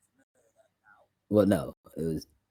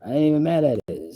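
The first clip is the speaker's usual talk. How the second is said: normal talking voice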